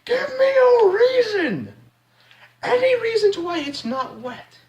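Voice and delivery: very high, drawn-out squealed demand; exaggerated falsetto outburst, as if slapping them; mock outraged demand. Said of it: "I'm gonna slap them and say".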